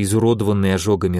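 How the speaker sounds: ordinary delivery, nothing marked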